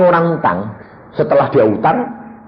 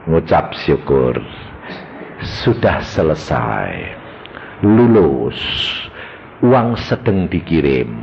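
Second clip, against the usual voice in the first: low, slow divine pronouncement; voice of God; 8 semitones lower, slower than usual. Retold or imitated. God answers a praying husband about the rent, slow low pronouncement. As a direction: intone it slowly, pitched down. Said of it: "Tuhan ini ngomong apa?".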